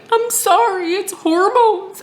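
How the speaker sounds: very high, imitated crying wail; mock tearful apology. Crying wife apologises to husband, mock sobbing. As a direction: squeak it out as if sobbing, drawn out at the end.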